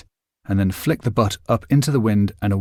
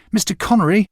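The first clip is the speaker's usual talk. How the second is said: higher and louder still, urgent shout; boy calling after Connery again, the louder shout; louder second call; 9 semitones higher, 4 dB louder than usual. A narrator's reading. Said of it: "I called to him".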